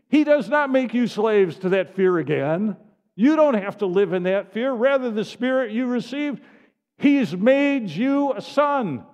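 loud, driving sermon delivery; full-force preaching. Acted. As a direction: preach it out to the whole room.

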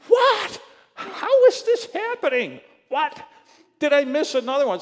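high, loud outburst of disbelief; shocked disbelief. Retold or imitated. Pastor recounting his discovery of late fees, shocked.

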